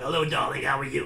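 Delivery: loud, raspy old smoker impression; smoker grandma. Teacher mimicking a scarred-voiced teenager, smoker-grandma rasp. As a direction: rasp it like a lifelong chain-smoking grandma. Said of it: "sounds like a grandma that's been smoking".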